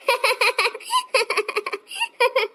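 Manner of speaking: long, high fit of giggles; high laughter in repeated bursts; laughing. Acted. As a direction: burst out laughing at mother-in-law. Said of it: "बहू, ऐसे नहीं हंसती, क्यों हंस रही हो?".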